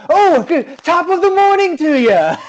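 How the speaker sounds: high, loud, laughing hammed-up good-morning greeting; fake cheery greeter. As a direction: force a chirpy over-the-top politeness. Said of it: "being like".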